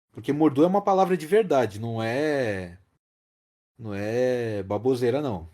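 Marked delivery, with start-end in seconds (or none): none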